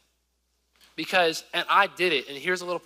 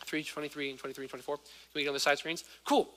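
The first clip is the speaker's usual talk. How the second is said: ordinary talk, nothing marked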